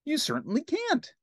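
very high cartoon pal; Ernie. Ernie agrees with Bert, high cartoon voice. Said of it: "Ernie said".

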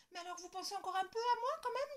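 put-on high, fast relieved child; girl cheered by her parents. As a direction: brighten up, reassured. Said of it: "dit Lola, rassurée".

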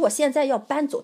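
a touch of a real angry outburst; retold angry reply. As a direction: tell him flatly you're leaving. Retells her angry reply.

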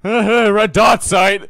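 high, slow laughing yell; laughing shout. Real, not acted. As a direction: stretch it out slowly, laughing and loud. Repeats it laughing and loud.

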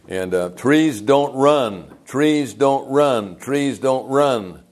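slow, weighty repetition of "trees don't run"; emphatic preaching. Acted. Pastor hammering a sermon line to the congregation, slow and emphatic. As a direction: drive each "Trees don't run" home slowly. Preacher repeats key phrase slowly.

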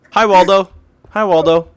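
playful high-pitched hello; silly high greeting. Pretend. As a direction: greet him in a silly squeaky voice. Greeting a player in game.

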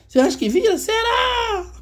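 very high squeaky voice on "Cê acha que vira? Será?", near a cry; squeaky mock doubter. Teacher mocking a doubtful viewer in a squeaky voice. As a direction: tease like a tiny doubting voice.

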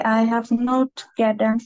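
normal talking voice

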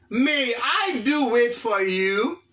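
drawn-out English question; very slow model phrase. Shown as a demonstration. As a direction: stretch every word for the class. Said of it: "teacher models English phrase". (kesyon anglè trè dousman)

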